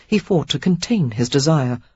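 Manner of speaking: plain reading voice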